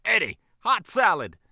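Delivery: loud, high shout to another room; calling out. Acted. Manager calling to the kitchen, loudly. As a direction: holler the order across. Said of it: "called out to the kitchen".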